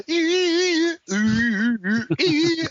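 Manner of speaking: high, loud squealing guitar "ee"; piercing mock guitar noise; imitated guitar squeal. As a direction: mimic a whining guitar riff with your voice. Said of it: "improv fucking guitar corner, you're gonna go".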